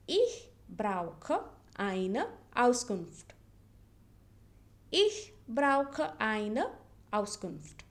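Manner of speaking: Ich brauche eine Auskunft drawn out slowly; slow model sentence. Shown as a demonstration. Teacher slowly modelling Ich brauche eine Auskunft.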